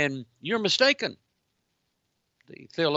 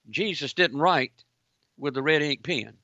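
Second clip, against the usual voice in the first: usual speaking voice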